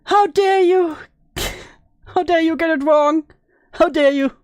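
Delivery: hammed-up outraged how dare you; shaking with anger. Mock outrage at the clip.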